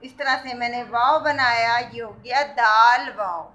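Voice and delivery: ordinary talk, nothing marked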